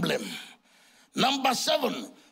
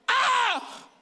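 very high, mock-shocked "Ah!"; shocked cry; 11 semitones higher than usual. Father recalling his son shouting back, high shocked cry. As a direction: exclaim "Ah" way up high.

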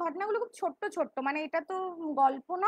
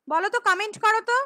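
loud, higher and fast; to someone hard of hearing; 5 semitones higher, 9 dB louder, faster than usual. Real, not acted. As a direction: call out for a reply. Calling for comments loudly.

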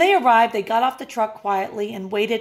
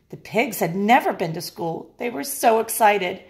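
ordinary delivery, nothing marked

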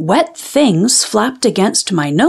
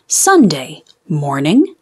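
ordinary delivery, nothing marked

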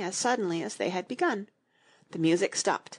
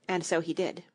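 usual speaking voice

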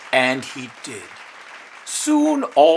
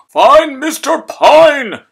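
loud, high, slow urgent shout; the mayor calling out for help; cry out; 14 semitones higher, 15 dB louder, slower than usual. A narrator's reading. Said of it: "cried the mayor".

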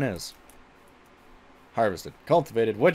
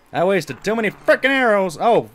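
frustrated; 8 semitones higher, 7 dB louder than usual. Real, not acted. Wasted arrows in game.

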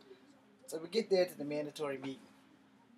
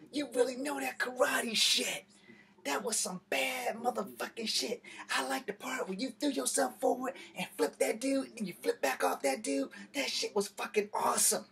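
excited coworker; 8 semitones higher than usual. Retold or imitated. Coworker gushing about the fight.

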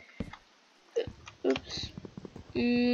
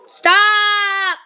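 high, very loud, long-held yell; real shout; 10 semitones higher, 15 dB louder than usual. Real, not acted. Player fed up with noisy people, exasperated shout. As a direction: bellow it to silence the room.

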